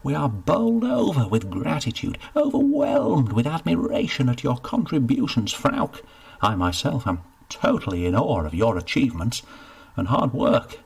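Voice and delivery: flustered official heaping praise; gushing steward. Acted. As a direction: overdo the flattery. Steward effusively reassuring.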